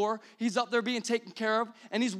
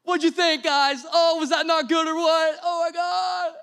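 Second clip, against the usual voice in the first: high, loud hammed-up gushing with a laugh; preacher lampooning a smug churchgoer, loud and hammed-up; mock gushing; 8 semitones higher, 8 dB louder than usual. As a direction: overdo the self-delighted fan.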